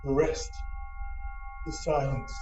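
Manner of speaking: low, fading last breath; faint deathbed whisper. Acted. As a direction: let the words die away. Hamlet's dying last words.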